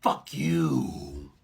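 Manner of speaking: low, hard-boiled growl; gruff cop line, deep and cool; wrong David Caruso. Pretend. Impersonating the movie line as David Caruso. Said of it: "That's the wrong David Caruso thing".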